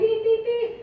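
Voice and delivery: high, bouncy beep beep beep like a horn; mock horn beeps. Imitating motorcycle horn.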